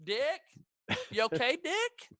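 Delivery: high falsetto, climbing questions; mock-worried damsel checking on him; Cherry calling out. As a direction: sing out each question, rising. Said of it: "her only dialogue in the movie is".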